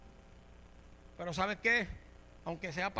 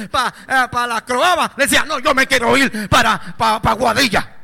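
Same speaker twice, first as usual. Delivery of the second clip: very loud, driving sermon shout; full-force preaching; 2 semitones higher, 19 dB louder than usual. Real, not acted. Preacher rebuking the stubborn sheep, shouting. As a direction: thunder it at the congregation.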